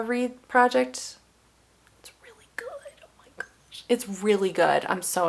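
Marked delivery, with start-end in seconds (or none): 2.0-2.7 whispered, hushed rave; barely-there whisper of praise; excited whisper. Real, not acted. Excited aside about a book. 3.0-3.8 hushed, whispered amazement; barely-there whispered gush; excited whisper. Real, not acted. Excited aside about a book.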